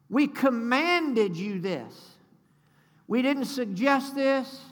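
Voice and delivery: slow, drawn-out, forceful proclamation; emphatic command. Real, not acted. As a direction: hammer it home slowly from the pulpit. Preacher drives home a scripture command.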